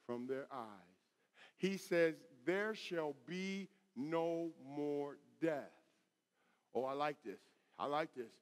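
soft, low, reverent scripture delivery; hushed preaching. Real, not acted. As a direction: savor the promise quietly. Preacher drops to reverent quiet.

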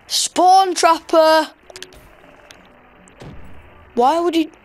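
name and shame the spawn camper; indignant accusation. Real, not acted.

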